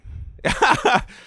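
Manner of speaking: high, loud laugh; burst of laughter. Real, not acted. Friend reacts to the blunt fat body advice, high laugh. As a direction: break into a high, amused cackle.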